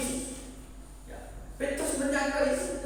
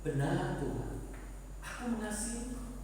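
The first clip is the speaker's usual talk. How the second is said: Peter answering quietly, much softer; soft reply; 8 dB quieter than usual. Acted. Peter quietly answers Jesus, soft and humble. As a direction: say it hushed and humble. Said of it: "Petrus ngomong gimana".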